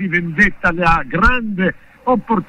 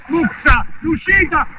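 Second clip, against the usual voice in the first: screaming goal call, much higher; breathless italian goal commentary; 13 semitones higher, much slower than usual. Real, not acted. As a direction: yell the goal over and over. Goal scored, commentator erupts.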